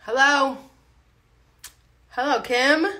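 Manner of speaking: loud call to someone. Real, not acted. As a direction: call out questioningly. Calling out to someone.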